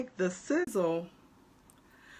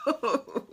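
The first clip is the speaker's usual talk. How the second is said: whooping laugh; 7 semitones higher than usual. Real, not acted. Laughing at her own outburst.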